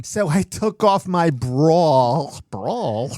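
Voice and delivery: hammed-up Brooklyn talk; New York accent. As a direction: exaggerate the New York aw, very slow. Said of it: "Which New York accent".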